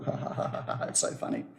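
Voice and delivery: soft, whispery pretend laughter; mock giggle, barely voiced; imitated children's giggle. A narrator's reading. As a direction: quietly mimic giggling kids. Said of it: "Children laugh, giggle".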